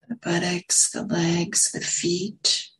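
unhurried meditation guidance with long pauses; guided meditation. Real, not acted. Meditation teacher settling listeners into posture and breath, guided meditation. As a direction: ease them gently into stillness, pausing between phrases.

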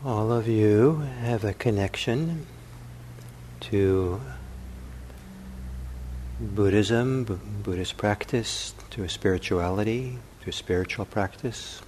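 unhurried meditation voice with long pauses; calm contemplative guiding, phrase by phrase; guided meditation. Acted. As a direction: softly offer questions, leaving silence between them.

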